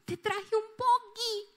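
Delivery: put-on a four-year-old offering a gift; little boy voice.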